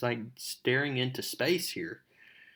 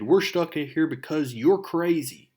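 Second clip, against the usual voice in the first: loud, higher mock argument; angry retort; 5 semitones higher, 5 dB louder than usual. Mary Jane yelling at Flash in detention, angry. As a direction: tell him off like a furious classmate. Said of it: "He said".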